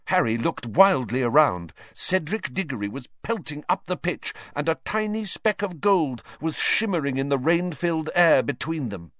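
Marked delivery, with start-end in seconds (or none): none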